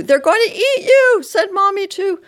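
very high, loud cry; loud, high-pitched taunting alarm; teasing scare shout. A narrator's reading. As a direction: shriek a mock warning at the child. Teasing shouts to frighten child.

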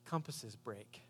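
low, soft, dry; lightly voiced cynical detective; grim retort. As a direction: drop it quietly and flatly. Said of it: "And he says".

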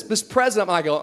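normal talking voice

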